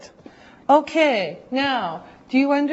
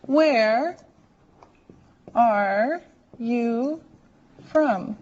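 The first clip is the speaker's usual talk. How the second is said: each word spaced apart with long pauses; slow, word by word; much slower than usual. Shown as a demonstration. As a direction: model it slowly, one word at a time. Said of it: "here's the question and how you say it".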